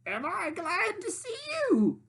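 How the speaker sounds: high, slow, sly cackling voice; cackling witch. Acted. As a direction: cackle it at the startled pig. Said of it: "she cackled".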